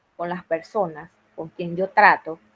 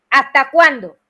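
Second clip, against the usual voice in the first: stern classroom warning, raised voice; firm, loud teacher voice; 5 semitones higher, 8 dB louder than usual. Shown as a demonstration. Teacher showing colleagues how she scolds a class, firm and loud. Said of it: "sale así firme y fuerte".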